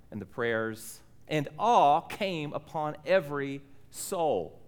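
measured, solemn reading of the text; scripture reading. Acted. Pastor reading Acts to the standing church, solemn and measured.

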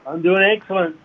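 speakerphone voice; talking through a speakerphone. Real, not acted. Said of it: "sounds like you're on speakerphone".

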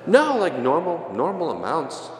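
high, squeaky innocent denial; defensive. Retold or imitated. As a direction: play it cool but the pitch gives you away. Dodging monsignor's pointed question.

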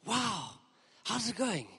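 high, breathless gushing chatter; gushing friend. Retold or imitated. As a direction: gush over Jesus like an excited friend. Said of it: "I sometimes see my wife like this".

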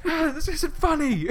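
very high, loud mock shriek; hysterical shriek. Woman finding her papers scattered outside, hysterical mock shriek. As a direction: shriek it out, hammed-up and frantic.

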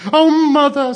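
put-on high, loud childish wail; hammed-up tearful child's cry; sobbing child. A narrator's reading. Blue Eyes crying to mother, sobbing. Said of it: "sobbed Blue Eyes".

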